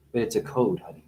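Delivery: normal talking voice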